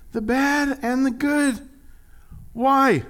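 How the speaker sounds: high and slow "the bad and the good"; stress on the bad and the good. Shown as a demonstration. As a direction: lean hard on "the bad and the good". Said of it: "it emphasized in that one little phrase".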